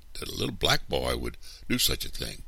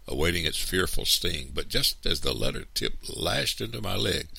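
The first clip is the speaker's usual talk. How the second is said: ordinary delivery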